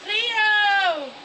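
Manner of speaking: loud high call across the yard. Real, not acted. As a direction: shout a name to someone far away. Calling someone over.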